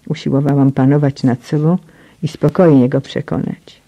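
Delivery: usual speaking voice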